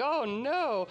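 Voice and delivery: a put-on shocked "oh no"; playful imitation of horrified court ladies; mock dismay. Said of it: "are like".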